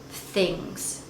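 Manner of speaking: ordinary delivery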